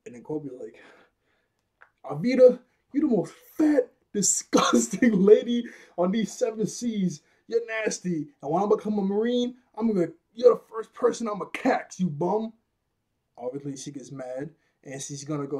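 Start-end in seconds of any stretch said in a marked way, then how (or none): 2.0-12.5 high, loud, hammed-up insult rant; mock defiant tirade. Kobe finally telling Alvida off, mock defiant. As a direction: let rip in a raised character voice.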